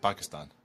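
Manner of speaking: "Pakistan" ending in "stahn"; "Pakistan", last syllable rhyming with "on"; affected pronunciation. Shown as a demonstration. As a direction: say "Pakistan" with an open final ah. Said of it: "I said Pakistan".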